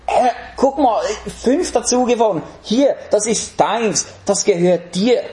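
high-pitched, delighted presenting; joyful servant. Acted. Servant showing his master the five gained talents, joyful. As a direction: proudly hand it all over with joy. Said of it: "freudige Sache für diesen Knecht".

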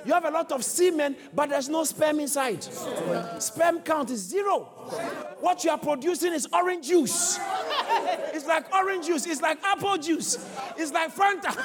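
high shout broken by laughter; laughing shout. Real, not acted. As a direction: laugh through the punchline. Preacher laughing while shouting.

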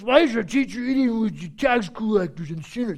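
put-on caricature voice for a Pharisee; mock the accusers in a silly character voice. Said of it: "The Pharisees talk like this".

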